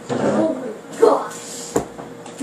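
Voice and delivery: slow whispered oh my gosh; slow hushed whisper; awed whisper. Real, not acted. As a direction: breathe it out slowly in awe. Awed drawn-out exclamation.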